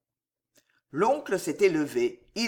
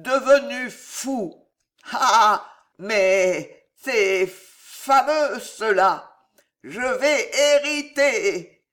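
put-on slow, higher drunken glee; drawn-out delighted drunkard; drunk Gillot; 4 semitones higher, 6 dB louder, much slower than usual. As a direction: stammer and gloat tipsily. Drunk nephew gloats.

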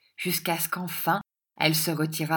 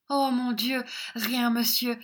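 put-on higher flustered young lady; flustered girl; 6 semitones higher than usual. A narrator's reading. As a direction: cover it up, all aflutter. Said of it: "Miss Squeers flustered reply".

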